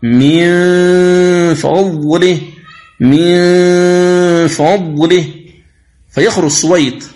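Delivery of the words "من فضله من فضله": من فضله read slowly, the نون of من a long nasal hum, lips ready for الفاء; slow recitation of من فضله; nasal ghunna. Shown as a demonstration. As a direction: hold the ghunna in the nose before فضله. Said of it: "لا بد أن يلاحظ القارئ".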